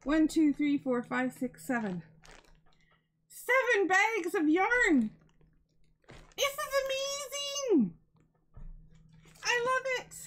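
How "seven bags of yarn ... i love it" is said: gush, the pitch jumping way up and louder; thrilled. Real, not acted. Unboxing the yarn gift for viewers, squealing with excitement. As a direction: voice soaring high with delight over the gift.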